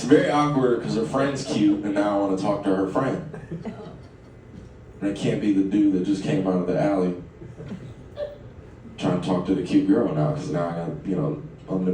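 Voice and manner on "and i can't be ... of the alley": usual speaking voice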